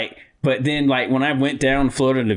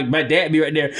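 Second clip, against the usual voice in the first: ordinary talk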